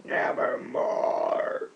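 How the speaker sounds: low, slow, breathy whispered croak; raven's reply. Acted. Raven answering the poet with a low, slow whispered croak. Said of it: "Quoth the raven".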